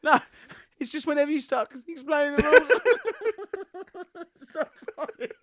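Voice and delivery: high, laughter-filled explanation; laughing. Real, not acted. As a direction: struggle to explain through giggles. Cracking up at rules explanation.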